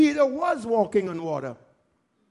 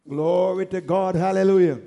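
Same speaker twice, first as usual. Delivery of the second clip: ordinary talk, nothing marked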